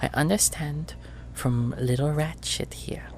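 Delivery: sweet, soft, menacing auntie voice; treacle voice. Acted. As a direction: threaten sweetly and quietly. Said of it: "treacle voice".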